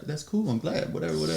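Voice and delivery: unconvincing breezy brush-off; feigned indifference. Retold or imitated. Feigns indifference to jealousy-baiting news.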